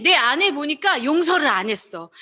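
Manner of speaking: ordinary talk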